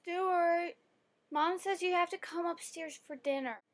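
put-on high, soft child voice calling out; little sister calling. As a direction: relay mom's order in a little-girl singsong. Little sister calls brother upstairs.

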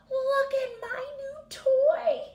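high, loud, slow joyful squeal; big excited Piggy. Acted. Piggy shouting to Elephant about her toy, thrilled. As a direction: shout your joy at the toy. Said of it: "she's saying it loudly or with lots of expression".

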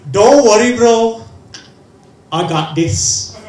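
loud, cocky reassurance from Amir; confident friend. Retold or imitated. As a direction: boom it out like a friend with a plan. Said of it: "He went to me and goes".

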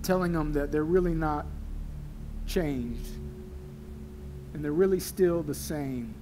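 low, steady pastor's prayer; earnest prayer. Real, not acted. Pastor praying over the congregation, earnest and low. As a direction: pray softly and sincerely over the congregation.

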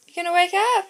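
high, loud coaxing call. Real, not acted. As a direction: shout it up to wake her. Waking a sleeping puppy.

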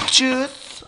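high-pitched juice. Pretend. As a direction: coo it like a small child. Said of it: "I just want some juice".

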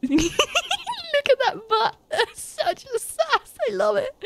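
high, drawn-out giggly squeal; delighted giggling. Real, not acted. Player admiring the goose's sassy waddle, giggly and high.

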